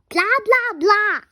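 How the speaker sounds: loud, high mocking blah blah; mock principal blah. Child reader mocking the mean principal, loud and high. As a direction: parrot the mean principal loudly.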